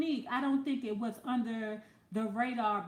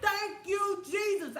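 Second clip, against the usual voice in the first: mock grateful shout; 7 semitones higher, slower than usual. Retold relief at escaping.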